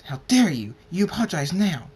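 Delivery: loud, high outraged demand; indignant scolding. Acted. Rainbow Dash scolds him.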